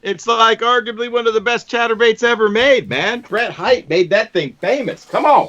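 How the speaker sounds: high, worked-up pitch, slowing on the name; can't believe nobody remembers it; excited disbelief. Real, not acted. Pleading that bait is remembered.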